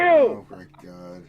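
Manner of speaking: high, loud, slow oh my God; exasperated groan. Real, not acted. Host reacting to a friend's antics on stream, exasperated.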